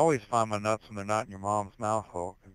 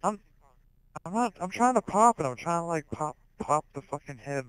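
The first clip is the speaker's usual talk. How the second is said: much higher, louder exasperated protest; flustered protest; 8 semitones higher, 4 dB louder than usual. Real, not acted. Streamer defending himself to chat, flustered. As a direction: insist you're only popping it.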